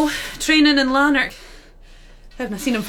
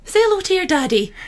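usual speaking voice

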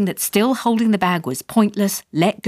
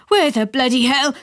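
mild yell; 7 semitones higher than usual. A narrator's reading. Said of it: "yelled Dad".

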